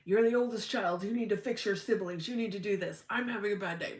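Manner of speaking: a hint of a father laying on guilt; demanding dad. Her dad phoning to demand she fix her siblings, lightly voiced pushy father. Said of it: "He would call me up".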